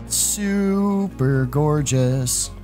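long, high stretch on super; gushing admiration. Real, not acted. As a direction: draw out SUPER in awe. Gushing over the game map.

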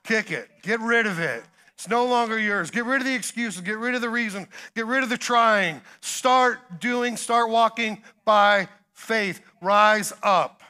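fiery preacher call to action; preaching at full force. Acted. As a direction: drive the crowd to their feet. Preaching climax over the mat.

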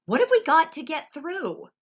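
high and loud, worried; alarmed shout; shouted question. A narrator's reading. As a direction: demand an answer. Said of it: "shouted Grandpa Joe".

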